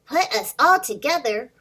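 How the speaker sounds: put-on lively storybook read-aloud for little kids; reading to children. Teacher reads a picture book to her students, lively and animated. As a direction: bring the picture book to life for the class.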